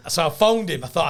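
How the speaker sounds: ordinary delivery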